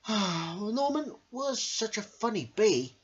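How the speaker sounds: slow, high, wistful bees; sighing little bees. Acted. Little bees sigh, missing Norman. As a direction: miss him with a sigh. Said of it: "sighed the bees".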